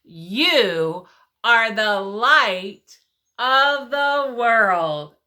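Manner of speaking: every word drawn out slowly and clearly; slow recitation. Shown as a demonstration. Teacher leading the children in the verse, slow and clear. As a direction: lead the class word by word, slowly. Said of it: "are you ready to say it with me".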